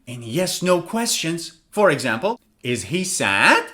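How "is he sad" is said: slow "Is he sad?" climbing on "sad"; rising intonation. Shown as a demonstration. Teacher showing a yes-no question, "sad" rising. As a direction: start low and swing "sad" upward. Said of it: "where do we use rising intonation".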